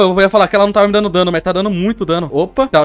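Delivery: ordinary talk, nothing marked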